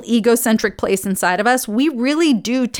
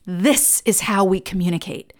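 ordinary delivery

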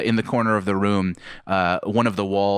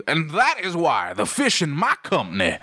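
a hint of a pompous fish merchant boasting; a touch of a proud fishmonger boss; lightly voiced businessman; 4 semitones higher than usual.

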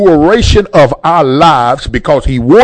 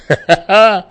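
brief real chuckle, raised in pitch; short knowing laugh; 5 semitones higher than usual. Real, not acted. Preacher host speaking of God on our side, short laugh. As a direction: let out a quick laugh mid-thought.

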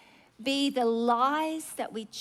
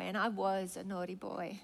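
put-on softer, lower echo of "naughty boy"; 5 semitones lower, 8 dB quieter than usual. Preacher echoing the man's shame, softly. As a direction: let "and I was a naughty boy" drop away sadly. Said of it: "come up to me this morning on the altar and say".

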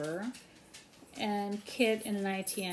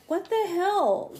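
indignant outburst; 8 semitones higher, 5 dB louder, slower than usual. Retold or imitated. Acted indignant quote.

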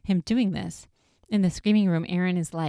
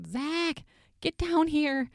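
freaking out; 11 semitones higher, slower than usual. Retold or imitated. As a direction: imitate a panicked man calling his friend. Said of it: "and he's freaking out".

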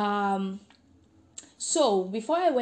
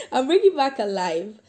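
louder, laughing through the words; 3 semitones higher, 8 dB louder than usual. Real, not acted. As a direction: laugh while reading it. Reading joking line in letter.

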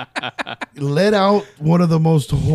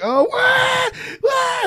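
high mock-panicked squeal; retold scream; much slower than usual. Wife hearing a shriek in the house, high panicked squeal. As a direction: shriek it as if something's wrong. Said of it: "starts screaming like".

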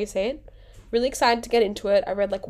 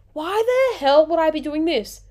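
loud, higher-pitched exasperation; fed up and incredulous, pitched up loud; exasperated; 7 semitones higher, 5 dB louder than usual. Real, not acted. Vlogger rebutting Illuminati rumours, exasperated.

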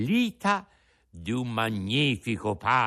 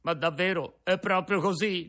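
sobbing; 7 semitones higher than usual. A narrator's reading. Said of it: "domandò singhiozzando il burattino".

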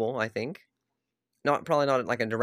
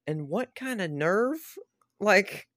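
slow, higher, outraged disbelief; real anger; 7 semitones higher, slower than usual. Real, not acted. Ranting at the game studio, angry. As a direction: spit out the outrage, slowly and up high. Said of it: "I was so mad".

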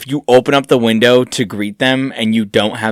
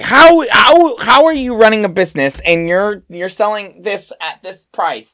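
high-pitched, indignant price rant; complaining customer; 9 semitones higher than usual. Acted. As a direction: argue prices in a raised, whiny-customer pitch. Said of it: "come through and complain about the prices".

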